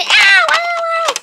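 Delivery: fake-cry in pain, overdone; hammed-up crying. Son being whooped by dad, hammed-up wails.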